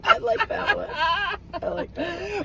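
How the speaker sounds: squeaky laugh through the words; barely getting it out through laughter; giggling high. Real, not acted. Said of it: "laughing while talking".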